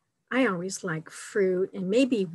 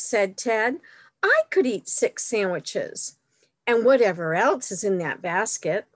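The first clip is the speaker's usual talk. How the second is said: delighted exclamation; 2 semitones higher, 4 dB louder than usual. A narrator's reading. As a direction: exclaim it brightly as a storybook bear. Reads picture book to children.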